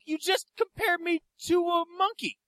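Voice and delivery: high, slow mock outrage; incredulous protest. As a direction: spell out the offence, high and slow. Acted quarrel with the fan.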